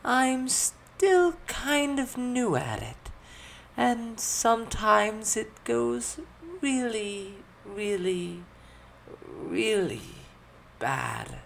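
high, very slow, hesitant voice; sheepish admission. A narrator's reading. Elizabeth admitting her magic can fail, sheepish high voice. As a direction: drag out the confession, dropping at the end. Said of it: "a bit sheepishly".

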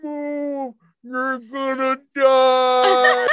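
drawn-out, high mock yell; slow-motion cry. Skier warning kid in her path, slow-motion shout. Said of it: "slow motion, man".

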